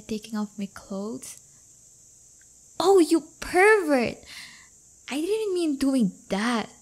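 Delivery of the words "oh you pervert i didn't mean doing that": loud, higher, slow mock-scandalised cry; shocked outburst. Real, not acted. Scolding her date for the wrong idea, loud mock shock. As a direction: catch him thinking dirty and call it out.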